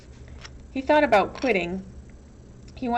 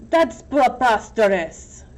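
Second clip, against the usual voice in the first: slow, loud outraged exclamation; Igor exclaiming; 2 semitones higher, 7 dB louder, slower than usual. A narrator's reading. Igor outraged at the idea of Myra deciding, loud exclamation. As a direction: spit out the outrage slowly and loudly. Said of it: "exclaimed Igor".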